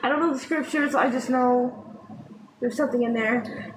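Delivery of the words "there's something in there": ordinary talk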